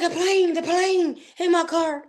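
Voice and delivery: high voice imitating an alarmed caller; retold frantic call. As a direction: insist in a high pitch, drawing it out. Retelling frantic crash phone call.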